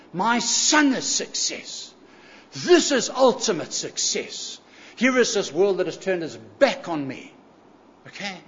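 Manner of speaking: ordinary talk, nothing marked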